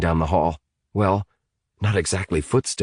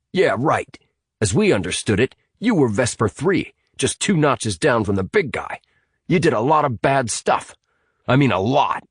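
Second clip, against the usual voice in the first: higher-pitched sceptical accusation; accusing Hamilton; 6 semitones higher than usual. Acted. Hamilton confronting Sinead about her past, accusing. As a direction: lay out the charges with scorn.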